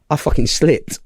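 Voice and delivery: ordinary delivery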